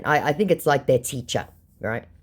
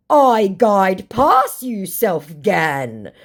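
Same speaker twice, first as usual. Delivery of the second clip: slow, raised, emphatic impression; mock indignant scammer; 6 semitones higher, 5 dB louder, much slower than usual. Scammer hitting back at the baiter, mock indignant. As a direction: drag out the retort in a put-on voice. Acting scammer's pidgin retort.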